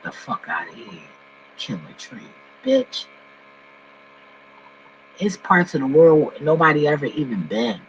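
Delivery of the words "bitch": high, drawn-out scornful "Bitch"; sharp high-pitched contempt. Real, not acted. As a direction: spit it out high and disgusted. Scornful exclamation mid rant.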